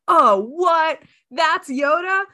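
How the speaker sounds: mock amazement at the reveal; shocked viewer. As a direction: cinema viewer stunned by the Yoda reveal, astonished. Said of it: "where they're like".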